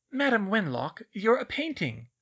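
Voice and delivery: pleading. A narrator's reading. Schoolgirl reasons with a muddled portrait, pleading. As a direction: plead with them to see sense. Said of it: "Hermione pleaded".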